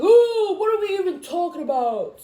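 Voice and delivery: high, loud mock bewilderment; exaggerated what-are-we-talking-about voice; mock confused. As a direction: play it baffled and sassy. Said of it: "So I was just like".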